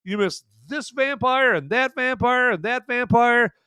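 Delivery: high, whiny imitation of an upset viewer; mock complaint. Imagined viewer protests the missed vampires to Van, mock whining. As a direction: list them off in mock outrage. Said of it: "Like, wah, wah".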